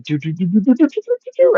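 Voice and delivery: rapid doo-doo-doo climbing very high; vocal sound effect racing upward in pitch; imitated action noise. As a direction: babble a rising run of doos to a peak.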